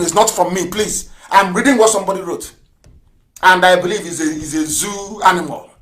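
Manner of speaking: ordinary delivery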